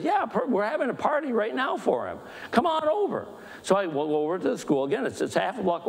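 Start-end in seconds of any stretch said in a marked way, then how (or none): 0.0-3.3 high-pitched, eager welcome; excited invitation. Retold or imitated. Pastor voicing Cindy inviting him to the party, high and excited. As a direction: imitate an excited woman inviting someone over.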